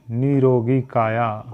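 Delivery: निरोगी काया said slowly and clearly; slow correct form. Shown as a demonstration. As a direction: teach निरोगी काया deliberately. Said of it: "शुद्ध होगा निरोगी". (निरोगी काया धीमे, स्पष्ट)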